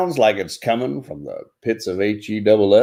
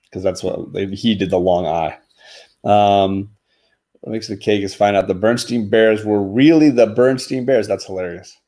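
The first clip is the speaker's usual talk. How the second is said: usual speaking voice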